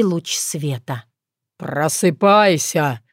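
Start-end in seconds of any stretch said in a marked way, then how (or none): 1.6-3.0 deep, slow booming orc voice; orc bass shout. A narrator's reading. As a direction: bellow it like a green giant. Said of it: "Громко прозвучал бас орка".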